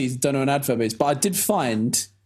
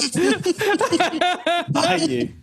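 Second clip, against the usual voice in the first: laughing mock hatred; 13 semitones higher, much slower than usual. Real, not acted. Friend ruined his pirate joke.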